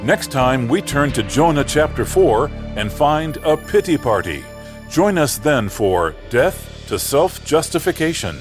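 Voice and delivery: ordinary talk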